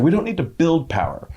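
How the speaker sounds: forceful, high-pitched declaration; Sarah Nelson, forceful. Retold or imitated. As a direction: quote a union leader's emphatic insistence. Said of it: "says very forcefully a lot".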